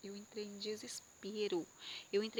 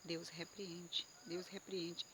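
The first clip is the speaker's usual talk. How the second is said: quiet silent prayer; 4 semitones lower, 9 dB quieter, much slower than usual. Shown as a demonstration. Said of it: "repreende em pensamento".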